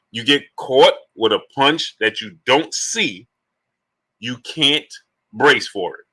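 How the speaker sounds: slow, separated phrases, stressing the key words; slow for you. Shown as a demonstration. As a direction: spell it out slowly, pausing after each phrase. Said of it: "I gotta say it slow for you".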